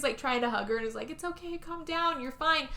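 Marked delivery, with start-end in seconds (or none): 1.1-2.7 high, soft reassurance; soothing. Retold or imitated. Husband hugs panicking wife to calm her, soft soothing voice. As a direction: gently hush someone who is panicking.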